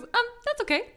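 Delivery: tactfully soothing; Dewey placating. Retold or imitated. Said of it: "and he just goes".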